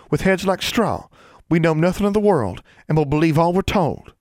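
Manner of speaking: high-pitched, aggrieved tirade; indignant retort. A narrator's reading. Constance tells how the school sees Ozarkers, indignant. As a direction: keep the grievance going, pitch up.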